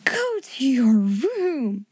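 slow, indignant order; breathless cry. A narrator's reading. Headmistress sending the pupil away, breathless indignant order. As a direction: draw out the command, flustered. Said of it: "cried Miss Minchin breathlessly".

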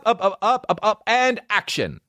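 high, playful director calling action; mock director. Host mocking the speech like a director shouting action. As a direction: hype it like a film director.

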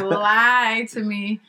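very slow stretched "Lie to me"; drawn out. Retold or imitated. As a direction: drag "Lie to me" out slowly. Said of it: "she had it drawn out".

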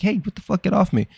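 high-pitched imitation of a whining kid; mock whining. Dad imitating his five-year-old in rough play, whining. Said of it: "starts whining and like".

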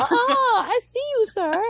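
very high, squeaky-pitched mock dismay; mock startled reaction. Singer spotting faces in the crowd, high mock-startled voice. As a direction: call it out high, hamming up the alarm. Reacting to spectators she could see.

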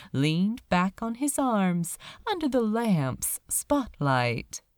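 usual speaking voice

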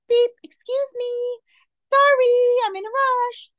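high, drawn-out apologetic beep voice; polite little horn. Retold or imitated. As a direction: squeak it out like a timid, sorry car horn. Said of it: "It wasn't one of those".

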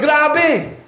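king gasping in disbelief, pitch leaping up; amazed cry of the king; astonished king. Retold or imitated. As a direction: play the king stunned at the fourth man. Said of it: "he goes".

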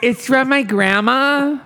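put-on customer voice. S1 plays customer.

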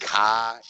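"ka", car with the r dropped; imitated confusion over a Boston "ka". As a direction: say "ka" as New Englanders say car. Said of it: "I don't know what a ka is".

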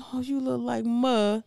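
soft, slow, wistful relative; gently mimicked sad fondness; imitated wistful sadness. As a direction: say it slowly, as if getting misty-eyed. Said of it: "they just get all sad".

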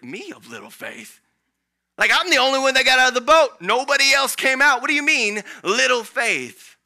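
loud, high protest of being called faithless; indignant Peter. Pretend. Peter protesting to Jesus, loud and indignant. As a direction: huff back at Jesus in mock outrage. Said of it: "I wonder if Peter was like".

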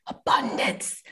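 Abundance whispered, slow and breathy. Shown as a demonstration. Sharing a secret about contracts with students, Abundance whispered slowly.

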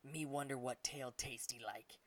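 slow, broken-English ape musing. Acted. Big ape eyeing the dragon's tail, in a slow hungry ape voice. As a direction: savour the thought like a greedy beast.

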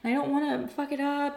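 lightly voiced high, sheepish self; high, sheepish excuse. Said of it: "And I'm like".

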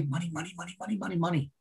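softly chattered mimicry, words run together; mimicking money chatter. Mimicking everyone who only talks about money, hushed chatter. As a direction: rattle it off under your breath like an obsessed crowd.

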